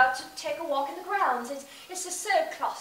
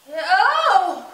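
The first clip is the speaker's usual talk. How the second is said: fake faint; 4 semitones higher, 14 dB louder than usual. Fakes fainting fit for escape.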